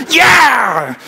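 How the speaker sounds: high, drawn-out team cheer; celebratory cheer. Retold or imitated. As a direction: cheer like a team bursting onto the field. Imitating celebrating football team angels.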